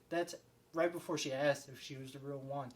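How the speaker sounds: ordinary talk, nothing marked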